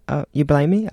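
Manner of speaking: usual speaking voice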